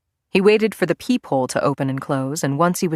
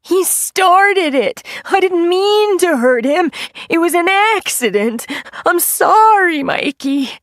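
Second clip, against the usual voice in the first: loud, high, slow blubbering; wailing, high and loud apology; high-pitched crying whine; 14 semitones higher, 6 dB louder, slower than usual. A narrator's reading. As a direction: whine through big-baby tears. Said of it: "crying in his peculiar high-pitched whine".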